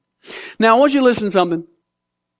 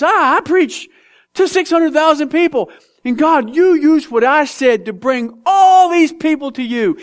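proud Jonah; 9 semitones higher than usual. Acted. Jonah voiced boasting.

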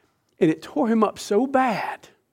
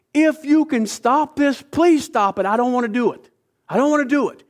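higher, louder, begging voice; desperate pleading; 7 semitones higher, 4 dB louder than usual. Acted. Jesus begging the Father to stop it, desperate plea. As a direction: plead as if terrified of what's coming.

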